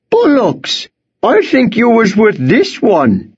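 high, indignant farmer voice; accusing farmer. Acted. Farmer holding Worm disputes the boy's story, accusing. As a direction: accuse him, pitched far up.

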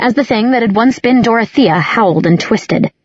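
plain reading voice

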